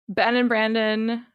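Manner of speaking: normal talking voice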